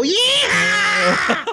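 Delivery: huge high-pitched whoop, drawn out; exuberant yell. Retold or imitated. Storyteller reenacting grabbing the dorsal fin, high whooping yell. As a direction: yell it like a rider taking off.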